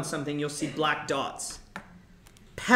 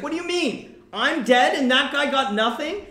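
much higher, loud, outraged cry; he can't believe Tybalt got away clean; angry outrage; 11 semitones higher, 8 dB louder than usual. Retold or imitated. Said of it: "He's so angry".

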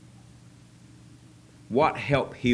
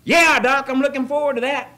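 high, loud sarcastic enthusiasm; mock eager; 10 dB louder than usual. Pastor voicing a smoker told to quit, high mock eagerness. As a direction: gush to the doctor like it's a gift. Mock joy at quitting smoking.

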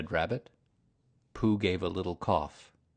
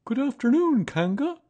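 much higher, louder bear greeting; cheerful, innocent bear says hello; Pooh voice; 9 dB louder than usual. A narrator's reading. Pooh greets Kanga, much higher and cheerful.

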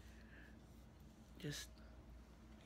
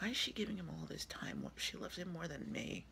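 ordinary delivery

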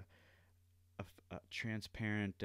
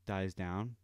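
usual speaking voice

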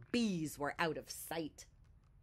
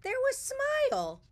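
very high, loud delighted reveal; joyful reveal; 6 dB louder than usual. A narrator's reading. Narrator reveals Smile has come back, joyfully. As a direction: burst out with the happy surprise. Happy climax of the story.

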